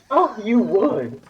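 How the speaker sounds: high, drawn-out mock flirty voice; playful acted jibe; teasing retort. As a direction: imitate a coy tease, slowly. Acting the patient's teasing reply.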